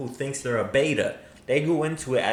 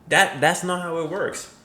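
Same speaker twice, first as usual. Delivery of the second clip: ordinary talk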